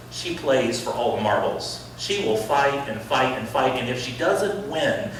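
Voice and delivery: normal talking voice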